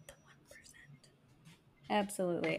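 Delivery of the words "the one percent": breathless unvoiced whisper. Shown as a demonstration. Host whispering an echo of her own joke.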